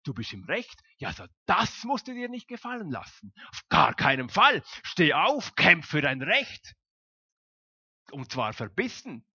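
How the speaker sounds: loud, high goading tempter; hammed-up tempter stirring up a quarrel; the evil one's voice. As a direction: egg them on to fight for their rights. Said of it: "das Böse wird uns auch einreden".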